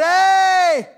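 high shouted plea for help; desperate cry for help. Retold or imitated. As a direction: call out like a blind beggar. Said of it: "Blind Bartimaeus said".

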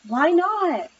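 high-pitched, upset question. A narrator's reading. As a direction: protest to mom, a bit upset.

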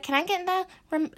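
high-pitched pestering sister voice; imitating sister. Sister asking for the magazine, high mimicked pestering. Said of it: "And she's like".

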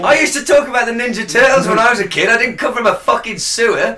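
high, loud comic rant; mock outrage. Co-host scoffs at the boy's Mars claim, mock outrage. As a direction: protest loudly in exaggerated indignation.

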